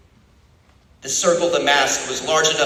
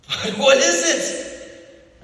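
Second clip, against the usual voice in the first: high, pleading, frightened cry; desperate cry; 14 semitones higher, 4 dB louder, slower than usual. A narrator's reading. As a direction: beg for an answer in panic. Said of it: "Winston cried".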